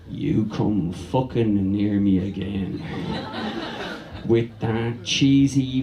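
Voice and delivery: low, slow, menacing growl of a woman in labour. Pretend. Woman giving birth threatens her partner, low and slow. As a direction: drag it out, low and threatening, at the man who did this.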